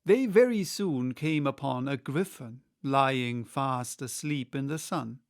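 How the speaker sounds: ordinary delivery, nothing marked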